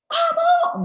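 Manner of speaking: loud, very high demonstrated yell; high-toned verbal abuse. Shown as a demonstration. Parent verbally attacking a child, high shout. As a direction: snap it out at the top of the voice. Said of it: "dengan nada yang tinggi".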